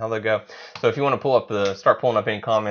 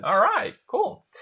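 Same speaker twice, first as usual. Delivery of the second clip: high-pitched; 14 semitones higher, slower than usual. Real, not acted. Sudden high slow reply.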